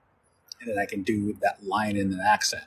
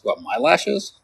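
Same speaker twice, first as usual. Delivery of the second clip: lashes rising high, a touch louder, taken aback; mock surprise; 6 semitones higher, 5 dB louder, slower than usual. Someone teased about lashes, mock surprise. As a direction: play it mock-offended at the lashes remark. Indignant reaction to lashes remark.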